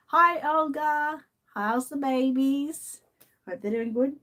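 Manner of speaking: sing-song greeting. Real, not acted.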